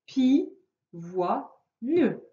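"pi, voix, ne" said as three separate syllables with pauses between; split into syllables. Shown as a demonstration. Teacher showing a child the syllables, slow separate beats. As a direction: chop pivoine into pi, voix, ne, one beat each. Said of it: "Le découpage en syllabes".

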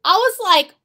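ordinary talk

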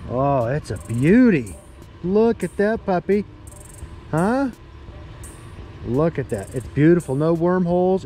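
usual speaking voice

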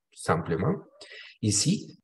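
usual speaking voice

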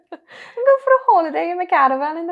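lightly done sing-song Welsh; a hint of Welsh valleys; Welsh accent. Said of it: "I do quite like the Welsh accent".

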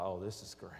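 low, soft, dismayed aside; wry inner thought. Retold or imitated. Driver thinking about his passenger, wry quiet dismay. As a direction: say it to yourself, drily and quietly.

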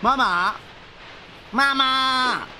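high, loud call for mom. Acted. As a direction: call out to mama across the room. Calling out to mother in game.

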